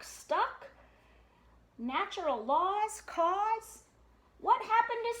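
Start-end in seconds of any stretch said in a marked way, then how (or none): none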